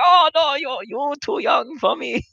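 high, slow, coy falsetto; mock waitress voice. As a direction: tease in the waitress's put-on voice. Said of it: "she's like".

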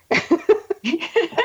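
a short, high, real laugh at the punchline. Real, not acted. Guest laughing at the end of her restaurant story, high laugh.

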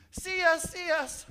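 crying out. Acted. People crying out to God.

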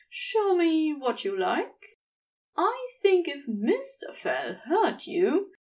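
Gabriel's coaxing voice. Acted. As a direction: coax them on like a smug seducer.

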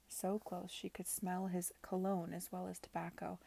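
ordinary delivery, nothing marked

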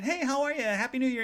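high, lilting friendly hello; chirpy greeting. Retold or imitated. Library visitor greeting a stranger at New Year, chirpy.

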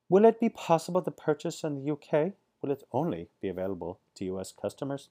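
high, loud mock-British questioning; hammed-up British accent, starting high; bad British accent. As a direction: put on a badly faked UK accent. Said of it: "that's not a really good accent".

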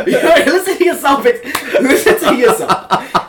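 high, slow, laughing through words; cracking up while scolding. Real, not acted.

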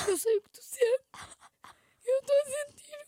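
high, teary voice of a friend consoling; tearful empathy. Retold or imitated. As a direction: play the crying friend who feels everything. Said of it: "e a Carol assim, a tocar assim no ombro".

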